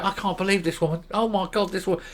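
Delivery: hushed, incredulous aside; mock disbelief. Fellow panellists reacting to this woman, mock hushed disbelief. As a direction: imitate onlookers murmuring in disbelief, softly. Voices onlookers' aside while laughing at her.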